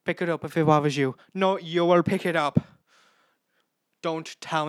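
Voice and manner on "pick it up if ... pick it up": raised, louder bickering; quoted reality-show argument; heated quarrel. Retold or imitated. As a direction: throw the order right back. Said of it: "This is from Big Boss".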